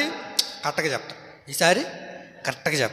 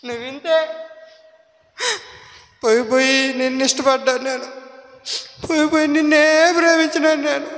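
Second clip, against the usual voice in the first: sulking wife; 8 semitones higher than usual. Acted. Delilah's tearful complaint to Samson.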